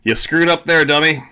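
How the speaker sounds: mocking scold. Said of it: "I'm like".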